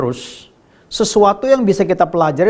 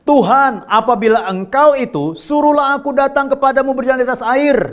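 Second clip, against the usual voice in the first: high, ringing scripture cry; Peter calling out; 6 semitones higher than usual. Acted. Peter calling to Jesus across the water, high calling voice. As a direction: call out to the Lord, pitched up. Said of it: "Petrus berseru dan menjawab dia".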